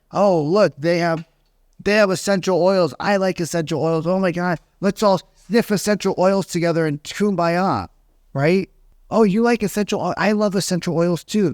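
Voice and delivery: high, hammed-up fake gushing over oils; mock gushing agent. Coached agent to homeowner, mock gushing over oils. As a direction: parody a salesy agent faking shared interest. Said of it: "taught by some coach to go into their house and".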